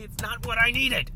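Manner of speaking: high, loud hammed-up protest; mock outburst. Imagined offended gate lady snaps, loud mock upset.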